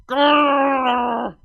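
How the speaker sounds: loud animal growl. Acted. Cadance growling at the quarrelling sisters. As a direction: snarl it out like a beast.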